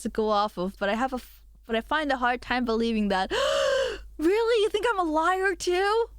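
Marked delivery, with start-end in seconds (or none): 3.4-6.1 playfully outraged at a doubting viewer; mock offended. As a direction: gasp and act hurt, much higher.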